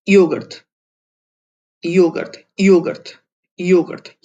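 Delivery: योगर्थ said loud and firm, repeated; model pronunciation. Shown as a demonstration. Teacher drills योगर्थ loudly for learners. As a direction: punch out योगर्थ loud each time. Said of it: "ये बहुत मुश्किल pronunciation है".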